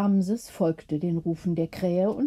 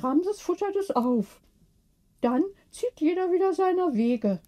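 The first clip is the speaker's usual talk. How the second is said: higher, lightly voiced elderly sister; chatty old lady explaining; sister's voice; 6 semitones higher than usual. A narrator's reading. Sister answers Gertrude.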